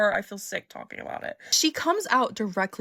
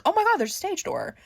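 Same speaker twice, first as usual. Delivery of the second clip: pitched-up surprised exclamation; suddenly spot it, higher and excited; 9 semitones higher, 3 dB louder than usual. Retold or imitated. Fans spotting the stage door, in surprised higher tones.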